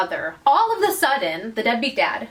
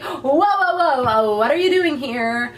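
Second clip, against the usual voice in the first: acted startled protest; 4 semitones higher, 5 dB louder than usual. She finds him at her door, acted startled protest. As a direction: play taken aback, louder and higher at first. Voicing her shock at his arrival.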